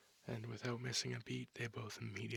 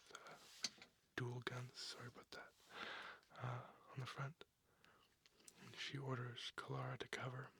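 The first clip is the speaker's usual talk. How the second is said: plain reading voice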